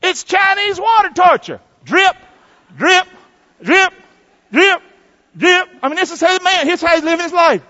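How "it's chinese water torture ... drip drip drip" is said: each drip high, loud and spaced. Acted. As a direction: tick out each drip like water torture. Preacher imitates dripping torture.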